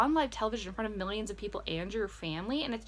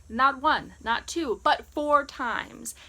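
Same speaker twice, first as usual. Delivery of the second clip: louder and louder, last phrase slow and punched; building emphasis; 5 semitones higher, 7 dB louder, much slower than usual. Real, not acted. Vlogger recounting Hannah's windmill reveal, punching each count. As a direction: stress four times, drawn out. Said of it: "emphatic count".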